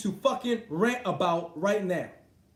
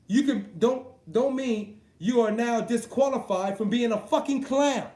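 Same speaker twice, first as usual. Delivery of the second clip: ordinary talk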